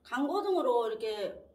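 chewing between words; talking around food; mouth partly full. Real, not acted. Seen 3: Lips pressed, chewing-like between words early; later just talking, no food.

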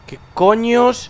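¿Qué coños? drawn out slow and high in shock; shocked disbelief. Real, not acted. Sees something strange, repeats.

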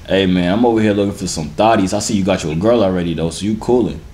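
normal talking voice

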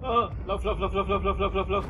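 panicked high urgent chant; frantic, pitch jumping up; being chased. Real, not acted.

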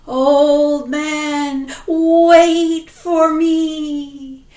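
loud, very slow ghostly call; haunting drawn-out plea; ghost woman. Acted. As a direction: stretch every word eerily. Ghost chasing.

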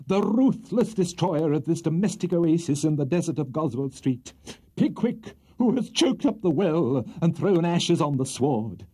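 milk the jury like a grandstanding lawyer; pompous courtroom orator. A narrator's reading. Buzfuz's closing speech to jury.

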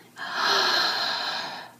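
long audible meditative inhale; big, demonstrative inhale; deep breath in. Shown as a demonstration. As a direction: breathe in deeply for listeners to follow. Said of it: "Breathe in".

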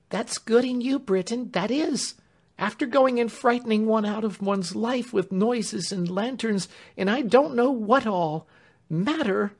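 high, loud indignant servant woman; flustered scolding. Acted. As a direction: scold him to cover your nerves, high and loud. Rattled Clemency scolding Britain.

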